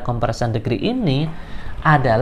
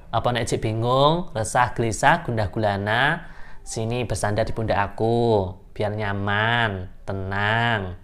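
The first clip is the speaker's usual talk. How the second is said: 1 mock tender; 4 semitones lower, 7 dB quieter than usual. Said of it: "Bercanda, guys".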